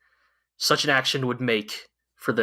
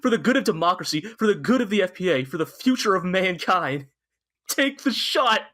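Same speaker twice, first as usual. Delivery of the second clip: impassioned mock plea, pitched up; begging; 4 semitones higher than usual. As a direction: build a pleading appeal to a high final urging. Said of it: "he's begging Yang".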